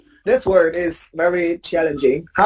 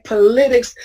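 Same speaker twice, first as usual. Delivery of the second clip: Politics said higher, as po-LIT-ics; wrongly stressed second syllable; 4 semitones higher than usual. Shown as a demonstration. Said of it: "but it's wrong".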